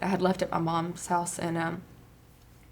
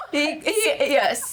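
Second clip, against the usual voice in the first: higher, giggling through it; laughing; 7 semitones higher, much slower than usual. Real, not acted. Recalling her husband's bid, giggling. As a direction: breaks into giggles.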